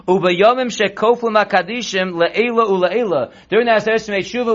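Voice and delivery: ordinary talk, nothing marked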